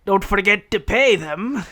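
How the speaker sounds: Roland. Acted. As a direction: call it back over the shoulder. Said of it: "says Roland".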